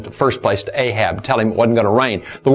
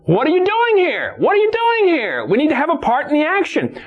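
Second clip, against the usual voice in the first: challenging preacher's cry. Retold or imitated. Preacher challenges those idle in the church, high cry. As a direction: cry out the question at the top of the range.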